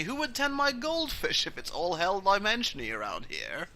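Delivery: lightly acted fussy father; dismissive vampire dad. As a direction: brush off the silly worry. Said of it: "Voltar Reigns goes".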